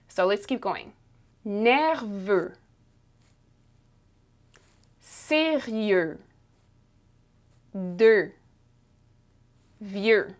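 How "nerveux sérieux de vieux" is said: "Nerveux", "Sérieux", "De", "Vieux" one at a time, ending on a closed eu; French pronunciation drill. Shown as a demonstration. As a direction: model "Nerveux" to "Vieux", final letter silent, vowel held. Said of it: "it has the same sound".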